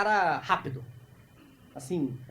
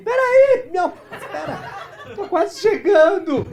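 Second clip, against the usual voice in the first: very high, loud, slow, laughing strain; hammed-up pleading to wait; straining tongue voice; 12 semitones higher, 9 dB louder, much slower than usual. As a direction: play a breathless tongue lagging behind. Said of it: "E sua língua tá assim".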